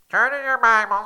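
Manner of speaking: high, loud, pinched nasal delivery, as he hears himself on tape. Shown as a demonstration. Pastor mocking how he sounds on tape, nasal and pinched. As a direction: squeeze it through the nose like a bad recording. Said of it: "I always sound like this".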